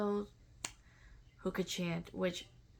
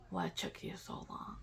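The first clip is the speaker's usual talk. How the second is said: breathy low dragon whisper, much lower and softer; hushed dragon voice; 7 dB quieter than usual. Retold or imitated. Dragon in her vision scolding her, hushed whisper. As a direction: let the dragon hiss it quietly.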